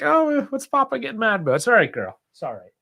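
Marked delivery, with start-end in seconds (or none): 0.0-1.6 high, puzzled voice for the dog; lightly voiced dog asking what's wrong; voicing the dog. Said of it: "My dog's like". 2.3-2.7 much softer, gentle repeat to the dog; tender, quiet reassurance for a pet; soothing dog. Real, not acted.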